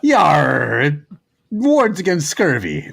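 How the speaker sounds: lightly voiced pirate, slow; a touch of pirate growl, half-laughing; mild mock pirate accent. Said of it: "He was also pi-, pirate".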